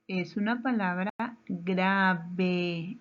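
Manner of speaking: slow, with "grave" drawn out in two beats; slow, spelled-out answer. Shown as a demonstration. Teacher giving the answer to the class, slow and deliberate. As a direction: stretch "grave" into "gra-ve" for pupils.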